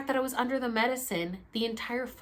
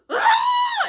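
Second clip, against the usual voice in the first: angry scream; 11 dB louder than usual. Retold or imitated. Furious scream after yelling.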